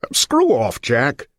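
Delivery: sharp, high, loud brush-off; cop snapping back. Acted. Cop shoves off a beggar for a phone loan, loud and high. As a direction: bark him away like a rude cop. Cop snaps at a pleading man.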